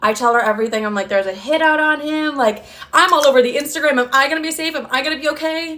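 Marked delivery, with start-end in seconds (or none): 1.1-5.8 high-pitched acted panic; worked-up, anxious retelling; panicked pleading. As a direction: replay a frantic call, voice pushed up high. Acted panicked call to ex's mom.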